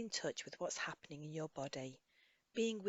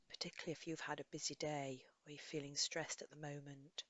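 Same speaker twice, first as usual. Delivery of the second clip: soft, unhurried guidance with long pauses; guided meditation; 5 dB quieter than usual. Acted. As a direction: gently lead a listener through a body scan. Leads a body scan meditation.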